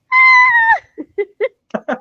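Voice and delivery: very high, loud squeal of joy; delighted shriek. Retold or imitated. Complimented by the actor, she squeals high and loud. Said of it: "I was so, so happy".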